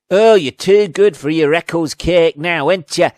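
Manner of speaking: put-on the worst Northern accent, belted out; bad Northern accent. Antagonist taunting the character over Eccles cakes, loud bad Northern accent. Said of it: "Northern accent you've, uh, ever heard".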